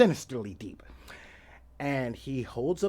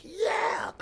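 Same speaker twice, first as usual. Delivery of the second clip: short yell, much higher, like landing a hit; triumphant yell; 3 dB louder than usual. Retold or imitated. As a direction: cheer your own perfect move. Triumphant shout after the kick.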